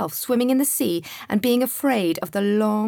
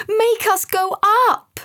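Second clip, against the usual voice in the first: high, loud urgent demand; 12 semitones higher, 7 dB louder than usual. Acted. Frightened boy urging Sam, urgent. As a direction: press hard to rise.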